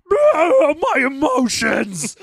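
high, hammy wail of emotions; mock overwrought Kalumi. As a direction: overact a whiny meltdown. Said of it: "and they're like".

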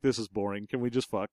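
low, soft, bored aside to a date; bored whisper-aside. Retold or imitated. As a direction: mutter it lazily in the cinema. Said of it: "I'd be like".

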